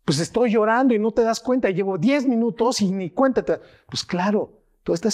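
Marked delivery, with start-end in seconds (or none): 0.0-3.6 high-pitched mock indignation; aggrieved protest. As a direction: protest like an ignored, tearful partner. Acts the aggrieved crying partner.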